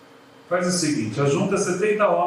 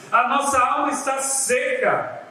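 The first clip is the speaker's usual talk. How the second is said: a theatrical, pitiful moan, pitched high; milk the drama of the complaint; mock dramatic whine; 6 semitones higher than usual. Preacher mocks the people's complaint in a pitched-up, theatrical moan. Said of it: "o drama".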